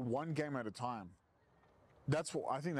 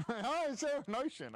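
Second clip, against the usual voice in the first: high mock voice; 12 semitones higher than usual. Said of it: "did you hear about Christmas".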